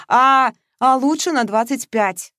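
parroting her friend, drawn out and mocking; mocking echo. Передразнивая подругу, протяжно. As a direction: Masha mimics Veronika's words back at her, mocking. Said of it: "гнула своё Маша".

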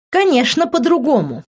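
sarcastic smirk. A narrator's reading. Liv scoffs at Vincent's claim things changed. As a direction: scoff at his excuse. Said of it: "усмехнулась она".

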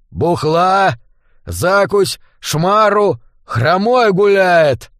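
high, loud exclamations with drawn-out words; possessed chicken. A narrator's reading. Parasite in a dead hen demanding booze, rowdy bird cries. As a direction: call it out as a rowdy undead hen. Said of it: "вырвалось из клюва вперемешку с кудахтаньем и бульканьем".

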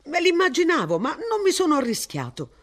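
mock-rueful with a chuckle; laughing. A narrator's reading. Martin joking with missus Ransom, laughing lightly. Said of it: "disse Martin ridendo".

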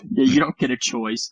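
ordinary talk, nothing marked

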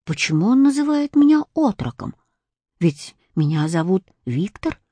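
ordinary delivery

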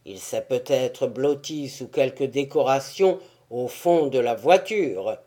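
low, loud stage tyrant. A narrator's reading. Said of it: "ajouta le tyran".

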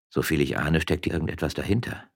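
ordinary delivery

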